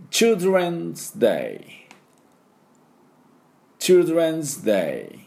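put-on Children's Day very slow and high; drawn-out English Children's Day; repeat-after-me model. Shown as a demonstration. Teacher models phrase for repetition.

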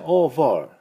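British "over" ending in open "ah", no R; British accent. Shown as a demonstration. Teacher comparing accents for learners, British "over". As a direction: drop the R in "over", British style. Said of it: "イギリス人はover".

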